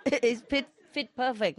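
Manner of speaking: giggling while speaking; amused laughing delivery. Real, not acted. Laughing at punchline.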